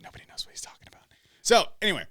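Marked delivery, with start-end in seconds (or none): 0.0-1.0 hushed aside under the breath; whispered aside. Real, not acted. Muttered aside about co-host's reference.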